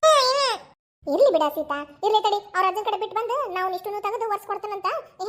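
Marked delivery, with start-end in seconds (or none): 0.1-0.6 loud, high whimpering cry. Real, not acted. Small boy scolded by his mother, crying. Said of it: "ಯಾಕೆ ಅಳಾಕತ್ತಿಪ್ಪ".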